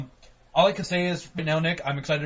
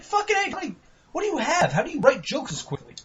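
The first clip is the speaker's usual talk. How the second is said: much higher mock fawning voice; 13 semitones higher than usual. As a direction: gush at him in a silly high voice. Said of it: "I was like".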